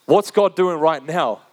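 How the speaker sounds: ordinary delivery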